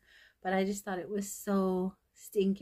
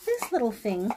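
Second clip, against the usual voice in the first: excited reveal; 13 semitones higher, 4 dB louder than usual. Real, not acted. Excited reveal of next item.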